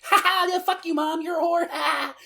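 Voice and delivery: put-on high, loud, jeering kid voices; mocking bratty kids. Kids sassing their mom about cleaning their room, high and loud jeering. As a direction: taunt Mom like a defiant brat, way up high. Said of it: "And they're like".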